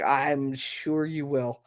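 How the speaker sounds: drawn-out, unimpressed agreement; dry skepticism. Retold or imitated. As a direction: humor the kid drily. Mother dryly answering son's threat.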